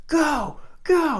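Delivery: high, loud coaching yell; urgent shout. Retold or imitated. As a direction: send a surfer onto the wave with a shout. Retells calling surfer into wave.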